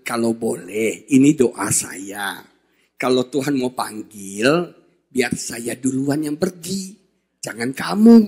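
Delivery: slow, soft, earnest plea to his wife; tender prayer. Retold or imitated. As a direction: pray it gently, like a vow. (doa lembut dan pelan)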